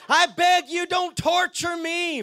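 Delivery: loud, high demon begging, last words stretched; hammed-up cry for mercy; acted shrieking plea. Demon begging Jesus not to torture him, acted shriek. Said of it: "with a shriek, he screamed".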